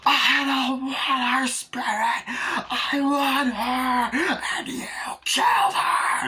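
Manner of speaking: drawn-out, very high strained shriek; hysterical screaming rage. Retold or imitated. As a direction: scream it slowly like an unhinged fan. Acting out enraged screaming outburst.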